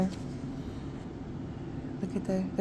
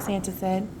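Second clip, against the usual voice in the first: ordinary delivery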